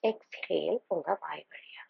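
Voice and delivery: soft, slow, whispery exhale; hushed, airy breathing instruction; breathed-out exhale cue. Shown as a demonstration. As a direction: let the words go out on the breath. Breathes out while guiding the exhale.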